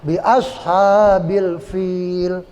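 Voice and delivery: very slow "fil" with f and long i; correct recitation. Shown as a demonstration. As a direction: draw out BI ASHABIL FIL, f not p. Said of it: "Jangan pil".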